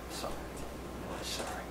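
whispered, barely audible apology; hushed condolence. Retold or imitated. Photographer consoling bereaved parents, whispered apology. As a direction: offer sympathy almost without voice.

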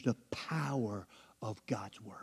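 slow, soft whisper; hushed aside. Acted. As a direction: drop to a whisper to draw them in. Hushed line inside the sermon.

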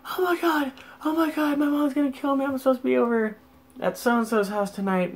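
put-on high falsetto panicking girl; hammed-up falsetto panic; mock freaking out. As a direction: imitate her meltdown in a squeaky high voice. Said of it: "She's, like, freaking out".

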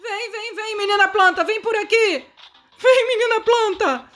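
high, coaxing calls to come. Real, not acted. Gamer calling the plant girl to follow, high coaxing calls. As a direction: call her over in a high voice.

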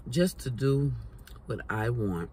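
words through a mouthful; chewing between words; talking while chewing. Real, not acted. Seen 4: Food glimpsed in mouth at 0.2; holds chip at 3.0-3.8.

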